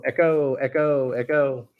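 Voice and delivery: slow echoing call through an effect; toy echo microphone. Pretend. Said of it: "Whistle effect".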